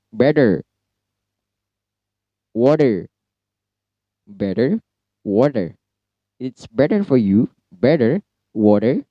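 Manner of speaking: "better" and "water" as "bedder" and "wadder"; American soft D. Shown as a demonstration. Teacher modelling American flapped T for students. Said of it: "cara bacanya better".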